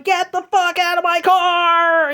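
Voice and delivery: high mock shout at her; imitated yell. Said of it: "repeatedly going".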